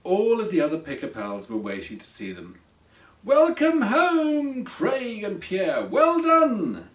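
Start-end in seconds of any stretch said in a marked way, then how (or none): 3.2-6.9 high, slow, drawn-out cheer; happy shout. A narrator's reading. Waiting pals greeting Craig and Pierre, happy shout. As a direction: call out a joyful welcome. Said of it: "they shouted out happily".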